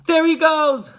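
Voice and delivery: high, loud excited cry; sudden piercing call; shouting. A narrator's reading. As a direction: shout it out to the crowd. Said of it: "someone shouted".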